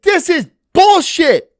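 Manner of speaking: retelling his outburst watching the fight; mock outraged yell. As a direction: blurt it out in disgust. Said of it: "And I was like".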